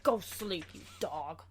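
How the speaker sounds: sassy cat scolding the dog; bossy cat talking down. Pretend. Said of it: "that's my cat voice".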